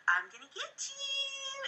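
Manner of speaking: put-on tickle-threat to make a baby giggle; playful baby teasing.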